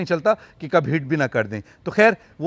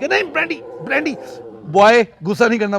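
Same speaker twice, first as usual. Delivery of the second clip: higher, louder call to calm the cat; 6 semitones higher, 4 dB louder than usual. Real, not acted. Owner calls out to Brandy to stop her anger, raised voice.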